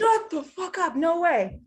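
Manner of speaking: high, loud stunned outburst; can't believe he's fifty-one; shocked disbelief. Real, not acted. Host hearing guest's age, blurts out in shock.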